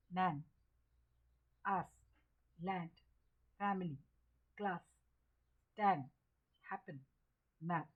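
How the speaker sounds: brief single words, open "a" as in land; teacher's list, short A as in map; short A demo. Shown as a demonstration. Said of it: "ये है आपका short ए". (हर शब्द में छोटा ऐ, जैसे map)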